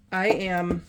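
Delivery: usual speaking voice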